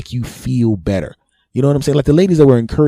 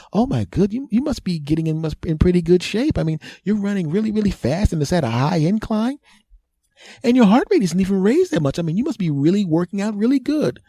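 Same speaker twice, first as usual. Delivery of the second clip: much higher, gushing female voice; admiring women; 9 semitones higher than usual. Retold or imitated. Attractive women praising the runner, high and gushing. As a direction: coo over the runner in a high voice.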